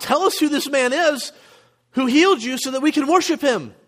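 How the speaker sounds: high-pitched, eager plea; imagined eager awe. Acted. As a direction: act out thrilled would-be worshippers.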